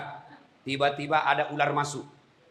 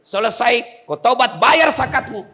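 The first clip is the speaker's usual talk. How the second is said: high, loud preaching; forceful exhortation; 9 semitones higher, 7 dB louder, slower than usual. Real, not acted. Preacher urging prisoners to repent and pay zakat, forceful exhortation. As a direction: press the demand hard.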